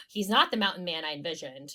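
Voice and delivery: normal talking voice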